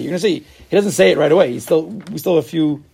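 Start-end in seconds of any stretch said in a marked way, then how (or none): none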